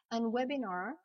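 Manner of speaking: "webinar" with an open English -ar ending; English pronunciation. Shown as a demonstration. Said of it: "ici se prononce". (« webinar » prononcé à l'anglaise, finale en -ar)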